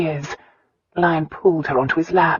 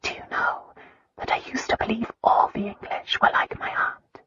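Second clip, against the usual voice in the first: hushed, tearful whisper, higher at the end; 7 semitones higher, 6 dB quieter than usual. A narrator's reading. Christina confessing to Lyon about the English, tearful whisper. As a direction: confide it in a near-silent, teary whisper. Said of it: "she suddenly whispered".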